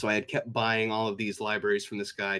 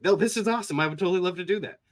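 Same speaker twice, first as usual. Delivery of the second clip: eager yes; 8 semitones higher, 6 dB louder, much faster than usual. Retold or imitated. Friend eagerly agreeing to record.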